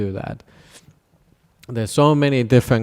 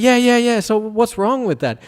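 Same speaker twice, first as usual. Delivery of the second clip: high, lightly voiced shrug of a question; mock-casual high voice; unbothered attendants; 11 semitones higher, 4 dB louder than usual. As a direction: play it breezy and dismissive. Attendants unimpressed.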